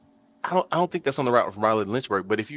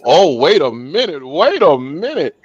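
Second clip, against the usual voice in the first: loud, drawn-out mock alarm; teasing excitement; 3 semitones higher, 5 dB louder, slower than usual. Real, not acted. Host catching friend hinting at a story, teasing excitement. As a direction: stretch it out, loud and rising, onto a scent.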